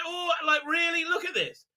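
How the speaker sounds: high-pitched exasperated disbelief; incredulous rant. Real, not acted. Reviewer ranting at the scene's silliness, high incredulity. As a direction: keep it pitched high, appalled.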